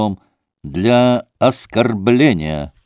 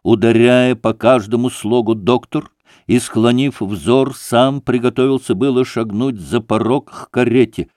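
ordinary delivery, nothing marked

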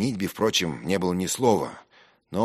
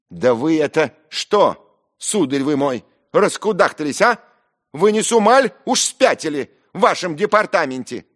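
high, loud furious scolding; scandalized, insulting shout; outraged tirade; 10 dB louder than usual. Acted. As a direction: tear into him at full pitch. Ferfichkin rages back in quarrel.

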